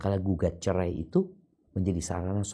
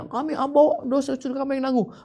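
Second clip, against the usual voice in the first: high mocking voice; mocking the boast; 4 dB louder than usual. Preacher mocking false promise to carry sins, high mock voice. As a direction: scoff at their empty promise. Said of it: "Nggak ada ceritanya".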